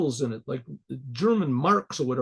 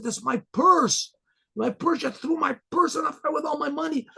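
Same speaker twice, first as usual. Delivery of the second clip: much higher, anguished cry of the baron; dismayed baron; 10 semitones higher than usual. Retold or imitated. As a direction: wail it as he sees his money burn. Baron dismayed at burnt purse.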